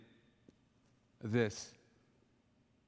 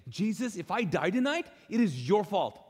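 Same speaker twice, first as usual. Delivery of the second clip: raised, emphatic retold challenge; angry blame; 5 semitones higher, 4 dB louder than usual. Frightened new believer blaming Jesus at bedtime, angry blame. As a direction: throw the blame upward, pitch lifted. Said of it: "rage or anger".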